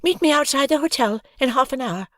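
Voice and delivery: a plan to a friend; girl character. A narrator's reading. Hoggy's voice.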